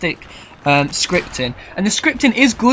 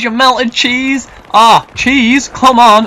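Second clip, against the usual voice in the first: drooling over cheese; 6 semitones higher, 7 dB louder, much slower than usual. Real, not acted. Raving about melted cheese.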